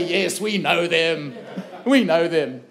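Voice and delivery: slow knowing nod with a laugh; laughing agreement. Shown as a demonstration. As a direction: say it laughing, slowly. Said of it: "Just say".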